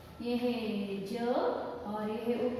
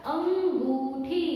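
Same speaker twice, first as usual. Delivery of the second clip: slow spelled-out reading; 2 semitones higher, much slower than usual. Shown as a demonstration. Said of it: "तो क्या हो गया शब्द?".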